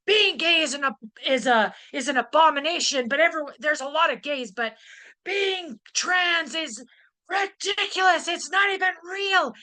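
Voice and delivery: high, heated mock rant rising into a shout; hammed-up furious man ranting, building to a yell; mock angry ranting. Angry man at convention booth ranting, mock yelling. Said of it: "just yelling and raging".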